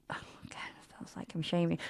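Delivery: quick whispered aside. Real, not acted. Telling a date story, a guilty whispered aside to listeners. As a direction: hush it fast, half to yourself.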